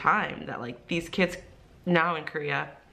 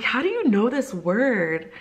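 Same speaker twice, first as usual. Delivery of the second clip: higher, slow retold disbelief; shocked; 5 semitones higher, 4 dB louder, slower than usual. Teacher questioning a student's vocabulary, shocked. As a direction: ask it slowly, in raised-pitch astonishment. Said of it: "I was just in complete shock".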